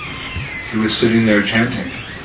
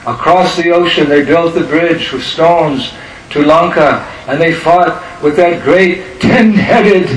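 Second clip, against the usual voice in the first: relate the battle like a devotee getting carried away; retold excitement; 4 semitones higher, slower than usual. Ram Bhakta relating Lanka battle to the boys, building excitement. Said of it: "he was getting all excited".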